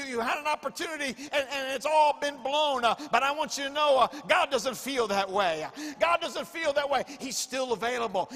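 loud, rising preacher's cry; full-force preaching. Acted. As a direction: drive it home to the congregation.

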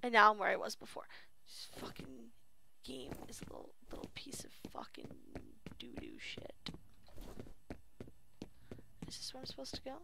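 soft whispered cursing. Real, not acted. Gamer whispering curses at the frustrating game. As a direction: whisper the swearing under your breath.

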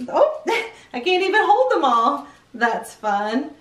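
usual speaking voice

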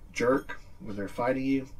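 ordinary delivery

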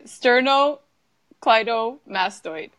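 slow, careful Sternocleidomastoid, part by part; said slowly. Shown as a demonstration. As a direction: break Sternocleidomastoid into clear slow chunks. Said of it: "I'm just gonna say it slowly".

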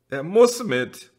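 high and loud, slow and firm; insistent cry. A narrator's reading. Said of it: "sagte Jana Bertha". (eindringlich und laut)

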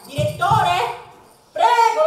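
calling loudly for someone. Acted. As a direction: call across the building. Chiama il direttore a voce alta. (chiamata a gran voce)

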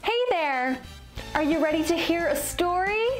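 ordinary delivery, nothing marked

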